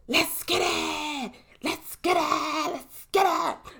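high, drawn-out hype chant; hype shout. Pretend. Host launching the next segment, high hype shout. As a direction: call it out way up high, stretched.

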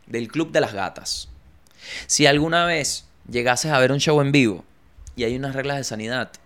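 ordinary talk, nothing marked

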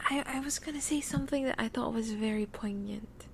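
sleepy soft whisper. Real, not acted. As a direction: whisper it, half asleep. Sleepy speaker trails off.

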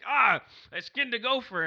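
high, loud mocking boast; hammed-up bragging friend; mock brag. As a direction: brag like a proud hunter. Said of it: "you'd be like".

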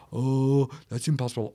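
lightly voiced gloomy naysayer; a hint of mock Eeyore defeatism. Naysayer dismissing a dream, mild Eeyore impression. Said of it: "the Eeyore spirit".

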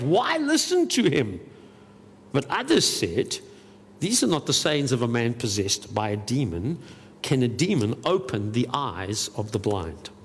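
measured Bible reading, voicing each side of the crowd; scripture reading. Preacher reads John's gospel aloud to the church, in a measured reading voice. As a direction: read the passage aloud to the congregation.

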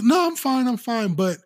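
a light put-on wife's voice insisting she's fine; wife reassuring. Imitating a wife who tells her husband she's fine.

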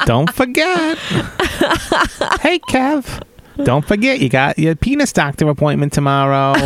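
very high cartoon assistant voice; chirpy high-pitched paperclip helper; Clippy voice. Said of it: "Clippy from Microsoft Word".

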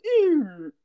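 choppy robotic glitchy ooh. Retold or imitated. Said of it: "you sound like a robot again".

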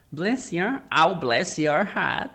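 badly faked Southern American woman; Australian trying a Southern drawl; attempted Southern accent. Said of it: "I can't do an American woman Southern accent".

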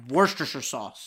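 Worcestershire said "WUR-ster-sur"; own pronunciation. Shown as a demonstration. Host showing how he would say it, Worcestershire as "WUR-ster-sur". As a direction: end Worcestershire in a swallowed "sur". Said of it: "That's how I would say it".

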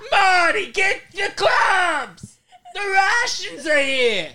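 high, loud, drawn-out Bronx screech; Italian Bronx woman. Acted. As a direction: scream like a hammed-up Italian Bronx mom. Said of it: "I could do that voice".

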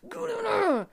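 building exasperated outburst; exasperated rant. Real, not acted. Ranting about customers in line.

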